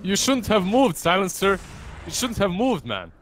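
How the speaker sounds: excited taunt. Acted. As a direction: gloat at the caught player. Taunting a hidden enemy hero.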